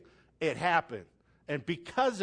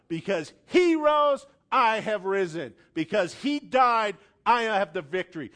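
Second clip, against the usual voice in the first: loud, forceful rebuke; shouting declaration; 3 semitones higher, 5 dB louder than usual. Pretend. Preacher modeling a shout against defeat, loud rebuke. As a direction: shout it down with full authority. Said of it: "shout at that thing and say".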